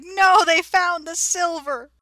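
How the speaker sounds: high, loud mock-outraged wail; mad banker. Host voices the banker learning of the found silver, super mad. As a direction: cry it out slowly in hammed-up fury. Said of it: "the banker is super mad".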